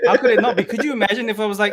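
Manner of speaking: high, loud, laughing disbelief. Real, not acted. Host laughs at the idea of another colour, laughing. Laughing at purple shirt surprise.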